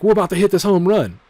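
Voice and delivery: stadium-sized we're about to hit this home run; hyped batter. Pretend. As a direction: pump up the crowd like a batter stepping up. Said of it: "I'm like".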